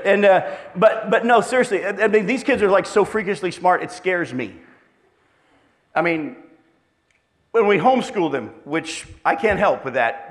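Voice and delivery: ordinary delivery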